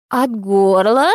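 slow questioning crone; old crone voice. A narrator's reading. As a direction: echo it back slowly like a crone. Crone echoes, slow far.

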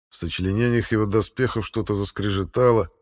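usual speaking voice

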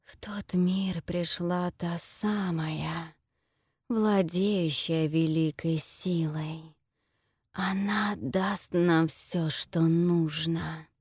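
slow whispering tree voice; talking tree. Acted. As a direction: whisper it slowly like an ancient tree. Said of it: "Дерево снова говорит".

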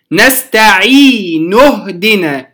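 نستعين اهدنا joined, the ي of نستعين held two counts; natural madd in connection. Shown as a demonstration. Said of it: "لما وصلته كان مدا طبيعيا". (وصل نستعين اهدنا بمد طبيعي)

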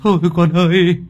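put-on a father sobbing over his child; wailing for his daughter; crying out. A narrator's reading. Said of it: "rồi anh cứ thế mà khóc".

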